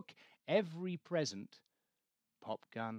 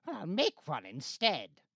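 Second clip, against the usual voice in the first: slightly louder matter-of-fact Grinch; lightly voiced Grinch; 5 dB louder than usual. A narrator's reading. Grinch deciding to make a reindeer, lightly voiced. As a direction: shrug it off plainly. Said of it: "The Grinch simply said".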